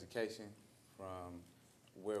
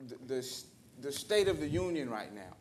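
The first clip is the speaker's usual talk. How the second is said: much higher and louder, building; rising to preach; 8 semitones higher, 13 dB louder than usual. Real, not acted. Man closing the circle on privilege, building to a loud preach. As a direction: preach it to the room with fire.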